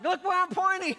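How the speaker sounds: teasing impression of an angry father; mocking dad. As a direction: daughter parroting her dad's frustration back at him. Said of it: "She was making fun of me".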